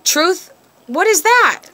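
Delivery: high, scornful question; scoffing. Real, not acted. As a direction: scoff at the very word. Scoffing at the idea of truth.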